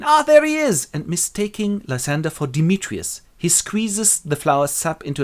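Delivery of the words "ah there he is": high, loud exclamation; delighted discovery. Acted. Puck finding the Athenian, delighted. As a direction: pipe up on spotting him.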